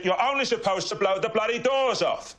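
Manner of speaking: slow, high-pitched Cockney outburst; Michael Caine. Shown as a demonstration. Friend clinching the contest, high slow Cockney outburst. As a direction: deliver the famous line high and drawn out. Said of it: "That's Michael Caine".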